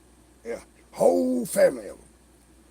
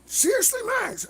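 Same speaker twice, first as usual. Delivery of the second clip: high, disbelieving seriously; incredulous. Retold or imitated. Bubba in disbelief about losing his parking, high incredulous question. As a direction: ask it in sheer disbelief. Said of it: "He says".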